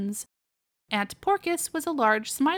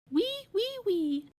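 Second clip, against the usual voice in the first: piglet squeak; 9 semitones higher, 4 dB quieter, slower than usual. Acted. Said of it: "answered Robinson".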